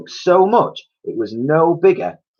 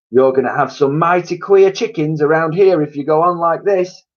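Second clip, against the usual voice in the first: higher voice, raised and wary; warning mother; 7 semitones higher, 6 dB louder than usual. A narrator's reading. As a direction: warn them about the chickens. Missus Cranky warning.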